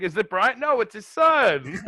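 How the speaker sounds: high-pitched puzzled question, loud drawn-out answer; mock confused commentators. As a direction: act out a confused exchange, rising to an exclamation. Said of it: "They're like".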